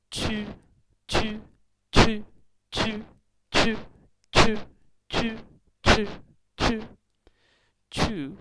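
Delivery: "chew" short, high and loud with a burst of air; punchy repeated "chew" drill; squeezed-lip chew. Shown as a demonstration. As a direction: push air through squeezed lips on "chew". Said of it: "you have to squeeze your lips".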